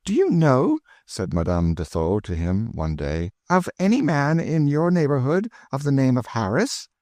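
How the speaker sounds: a hint of an eager French lady. A narrator's reading. Madame de Thou's voice in narration.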